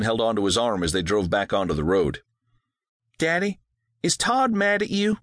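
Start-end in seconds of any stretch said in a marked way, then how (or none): 3.2-5.2 put-on high girl's voice, hesitant; young girl asking, higher; worried girl. A narrator's reading. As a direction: daughter asking her father.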